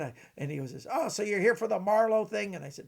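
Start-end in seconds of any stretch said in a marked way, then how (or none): 0.9-2.5 higher, louder magician impersonation; Vernon. Acted. Magician quizzing a young fan about the party, impersonated. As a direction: ask it in the raised character voice. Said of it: "this is my Vernon impression".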